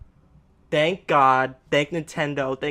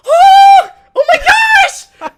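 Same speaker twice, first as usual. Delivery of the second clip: very high, loud, drawn-out squeal; excited scream; 18 dB louder, much slower than usual. Retold or imitated. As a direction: scream it out in sheer delight. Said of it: "I literally screamed like that outside".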